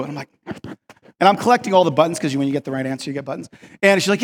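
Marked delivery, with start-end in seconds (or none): none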